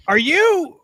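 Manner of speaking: high, loud excited gasp of a question; starstruck disbelief. Retold or imitated. Fan recognising Sky from a sticker, starstruck mock shout. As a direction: imitate a fan who just spotted someone known.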